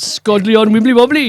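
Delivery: mock Welsh accent. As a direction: say it with a sing-song Welsh lilt. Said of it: "in my best Welsh accent".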